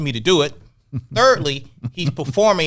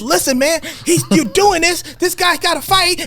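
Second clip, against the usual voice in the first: insistent pushing. Retold or imitated. Retold argument, Amir's side.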